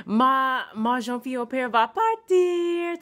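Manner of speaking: loud, high French announcement, drawn out; gleeful twelve-year-old. Retold or imitated. Twelve-year-old tells friend the au pair is leaving, mock excited shout. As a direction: imitate a child crowing the news to a friend. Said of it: "run in the bathroom and say".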